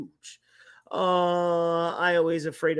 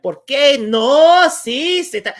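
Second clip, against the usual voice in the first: put-on loud, slow Spanish babble like an old lady; loud imitation of grandma's Spanish; mock grandma Spanish; 8 semitones higher, 11 dB louder, slower than usual. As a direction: throw out Spanish like grandma on the phone. Grandma rapid Spanish on phone.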